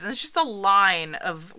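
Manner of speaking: ordinary delivery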